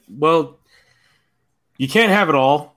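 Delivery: normal talking voice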